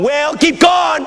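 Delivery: high, drawn-out shout of encouragement; imitated congregation. Congregation urges the old pastor on, shouting high. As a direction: holler it slowly from the pews. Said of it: "We were going".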